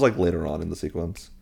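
normal talking voice

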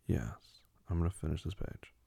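normal talking voice

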